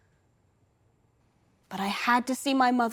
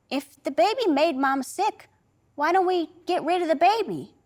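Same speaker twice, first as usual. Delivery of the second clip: lightly voiced child, higher pitch; a small child's earnest question, pitched up; child's suggestion; 6 semitones higher than usual. Child innocently proposes removing the baby.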